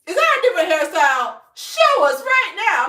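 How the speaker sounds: imitating pushy strangers, loud and high; hammed-up shrieked demand; demanding shout. Strangers demanding to see her hairstyle, shouting.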